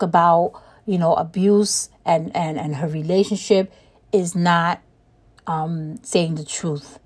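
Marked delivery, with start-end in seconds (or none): none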